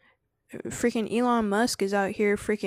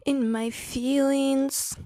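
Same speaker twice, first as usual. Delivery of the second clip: slow, stretched out and higher; in my feelings; 6 semitones higher, much slower than usual. Real, not acted. Telling the chat she's moody, drawn out slowly. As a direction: drag out the word feelings, sulky. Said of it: "I'm just feeling kind of".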